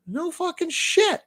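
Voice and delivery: high, slow incredulous cry; mock disbelief. To the bartender, stunned it's the last flight, high and slow. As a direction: drag it out, stunned. His stunned reaction retold.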